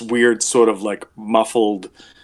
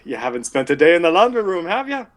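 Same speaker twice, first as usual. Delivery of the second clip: quick, raised character voice; needling laundry woman; 6 semitones higher, faster than usual. Acted. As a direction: challenge them, pitching up at the end. Laundry woman challenging the intruder.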